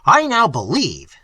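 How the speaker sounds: loud, barely controlled fury; trembling with rage. A narrator's reading. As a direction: shake with anger. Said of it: "trembling with rage".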